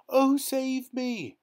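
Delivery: high, slow wailing plea; pleading cry. A narrator's reading. Hoisted hero wails to be saved, high and slow. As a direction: wail for rescue. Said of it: "our hero cried".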